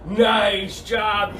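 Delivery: usual speaking voice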